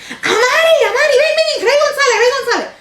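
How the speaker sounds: imitated grandma's high, loud call across the house. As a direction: shout the name up high to fetch someone from another room.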